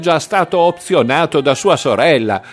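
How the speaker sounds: put-on high, loud drunken jibe; staggering drunk. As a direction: heckle as if reeling from drink. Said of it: "disse barcollando Vezzulli".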